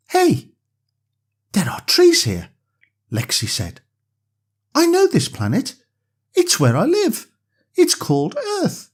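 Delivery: put-on high, delighted child recognizing home; little girl. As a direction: exclaim happily like a small girl. Lexi's voice.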